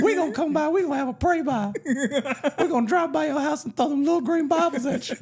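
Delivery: high mock-threatening grandma; hammed-up church granny issuing warnings; old lady.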